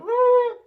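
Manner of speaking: wild, high, loud hyped-up woo; manic whoop. Retold or imitated. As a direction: whoop like someone wired on meds. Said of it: "they're just like".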